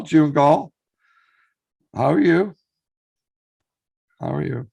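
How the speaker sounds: ordinary delivery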